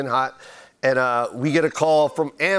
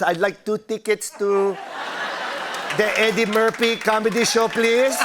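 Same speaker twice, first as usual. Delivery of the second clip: mom's voice; 5 semitones higher than usual. Retold or imitated. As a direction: put on your mom to fool a ticket seller. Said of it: "I used my mom's voice".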